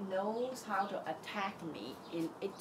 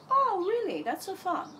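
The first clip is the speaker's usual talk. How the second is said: high, louder sarcastic jab; high, taunting inner voice; mocking mind; 10 semitones higher, 4 dB louder than usual. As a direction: needle yourself with mock disbelief. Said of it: "answer my mind".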